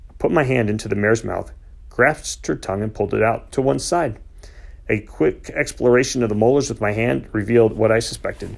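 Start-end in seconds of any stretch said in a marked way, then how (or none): none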